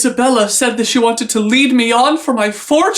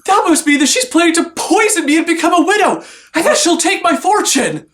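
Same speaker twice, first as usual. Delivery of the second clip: mock panic; 8 semitones higher than usual. Lover fears poisoning plot.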